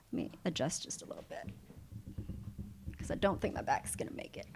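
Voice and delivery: very soft, barely voiced aside; quiet aside. Real, not acted. Instructor breaking off to adjust for her back, hushed aside. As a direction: drop to a murmur, half to yourself.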